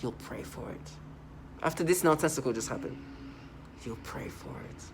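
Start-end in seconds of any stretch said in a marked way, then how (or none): 0.0-0.9 hushed, whispery mock menace; imitated menacing voice. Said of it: "the menacing voice". 3.8-4.8 hushed, whispery mock menace; imitated menacing voice. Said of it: "the menacing voice".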